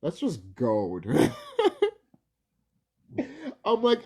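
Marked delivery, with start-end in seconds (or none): 1.1-1.9 a sudden high squeal of a laugh; high burst of laughter. Real, not acted. Vlogger cracks up at a silly book photo, shrieking laugh.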